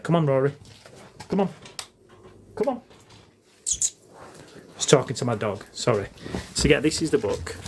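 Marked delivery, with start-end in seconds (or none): 0.0-2.8 short, higher-pitched calls to a pet; calling the dog. Real, not acted. Owner urging the dog to come on, calling the dog. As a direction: coax a dog along.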